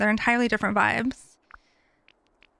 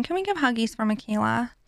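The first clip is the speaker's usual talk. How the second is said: ordinary talk, nothing marked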